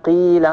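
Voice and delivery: قيل with the ee drawn out; lengthened vowel. Shown as a demonstration. Teacher showing that madd letters can stretch, lengthened vowel. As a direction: hold the long ya in قيل before the l. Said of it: "وقابلية التطويل وقابلية الزيادة".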